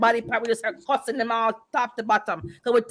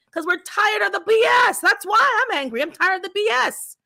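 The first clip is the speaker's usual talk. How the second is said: angry shout; 5 semitones higher than usual. Real, not acted. Woman venting to friends about racism, angry shout. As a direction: let the anger out, voice up.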